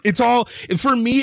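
ordinary talk, nothing marked

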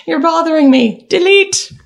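high, emphatic comic command; hammed-up irritation ending in a loud order; mock exasperated dismissal. Acting Janeway deleting a hologram.

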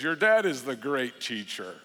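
a lightly voiced low grandpa passing the buck; grandpa's dodge.

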